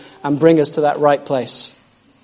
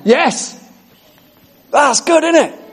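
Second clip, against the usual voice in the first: high, thrilled cry of joy; excited yes; 10 semitones higher, slower than usual. Real, not acted. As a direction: cheer it out, delighted by the promise. Preacher exults over scripture.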